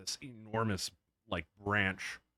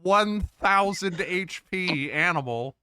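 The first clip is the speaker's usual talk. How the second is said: excited; 9 semitones higher, 9 dB louder, slower than usual. Real, not acted. Amazed at absurd stats.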